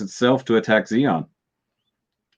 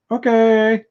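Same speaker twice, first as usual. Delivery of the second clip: much higher, louder bemused okay; incredulous okay; 13 semitones higher, 4 dB louder than usual. Real, not acted. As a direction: say it as if you can't believe it. Speaker's own reaction to retreat.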